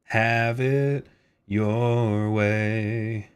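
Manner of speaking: very slow, drawn-out ad tagline; slogan voice. Pretend. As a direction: stretch it like a fast-food jingle. Slogan in put-on voice.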